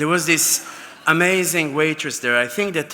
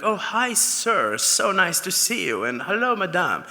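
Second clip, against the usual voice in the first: higher-pitched, fawning welcome to guests; gushing maitre d'; 5 semitones higher than usual. Retold or imitated. Maitre d' welcoming restaurant guests, gushing. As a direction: greet them with swooping, effusive politeness. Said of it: "the maitre d' will be like".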